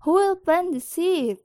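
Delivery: hen calls out high and loud to the barnyard; calling loudly. A narrator's reading. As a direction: call it out across the yard. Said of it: "she called loudly".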